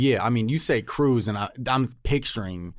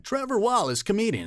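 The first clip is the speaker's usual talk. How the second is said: TV announcer; 9 semitones higher than usual. Retold or imitated. Gas station TV announcement.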